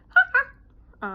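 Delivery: sudden loud high cartoonish laugh; tic laugh. Real, not acted. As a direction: burst out in a high squeaky laugh. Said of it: "I sound like Mickey Mouse".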